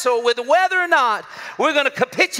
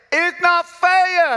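put-on child protest; 7 semitones higher, 5 dB louder, much slower than usual. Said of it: "is what he said".

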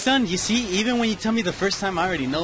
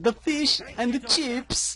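slow, high fake British; stretched-out mock British, pitched up; bad British impersonation; 6 semitones higher, slower than usual. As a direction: imitate a hopeless British accent. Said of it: "Do your best British impersonation".

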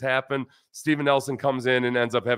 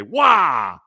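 loud, raised, drawn-out "Wah!"; exclaimed Korean wow; 4 semitones higher, 8 dB louder than usual. Shown as a demonstration. Host shows what he and his wife say, loud playful exclamation. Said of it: "randomly throughout the day will say".

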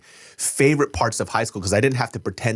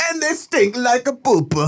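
put-on high, slow hammy French accent; mock French accent, slow and high; fake French accent; 12 semitones higher, slower than usual. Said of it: "Fake French".

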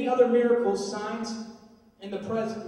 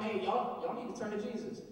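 skate park preacher; 2 semitones lower, 7 dB quieter than usual. Retold or imitated. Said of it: "at the skate park going".

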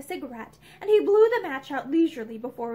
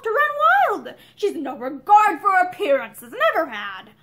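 loud, high-pitched exasperated rant; aggrieved tirade, dropping on the last words; irritable outburst; 5 semitones higher, 7 dB louder than usual. A narrator's reading. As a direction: rail irritably, voice climbing. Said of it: "he broke out irritably".

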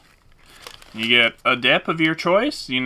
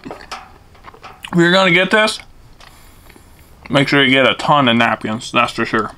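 chewing while talking; muffled eating speech; mouth full; 4 dB louder than usual. Real, not acted. Seen 3: Bit sandwich at -5; lips pursed, chewing motions while talking.

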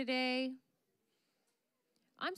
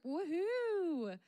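cheery woohoo; 7 semitones higher than usual. Real, not acted. Announcing young adults group.

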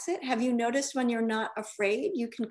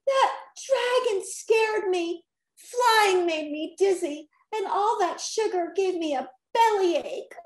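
crying; 8 semitones higher, 3 dB louder than usual. A narrator's reading. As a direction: whine it through tears. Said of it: "Rocky cried".

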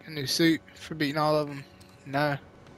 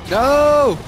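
yelling; 9 semitones higher, 8 dB louder than usual. Pretend. Streamer fleeing Rhino in game.

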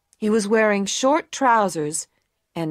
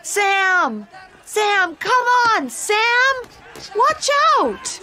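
anxious shout; 13 semitones higher, slower than usual. A narrator's reading. Anna screaming to Sam as he gets hit, frantic. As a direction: yell a warning across the ring. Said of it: "Anna shouted".

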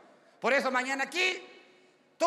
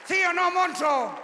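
high, loud, slow shout at the crowd; a big hammed-up shout to the audience; rowdy crowd callout; 9 semitones higher, 7 dB louder, slower than usual. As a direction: bellow it out to rile the room.

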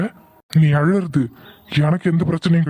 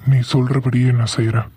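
ordinary talk, nothing marked

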